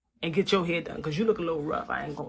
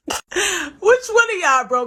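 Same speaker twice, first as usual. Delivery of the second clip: slow laugh through the words; laughing in disbelief; 7 semitones higher, slower than usual. Real, not acted. Laughing at the rant.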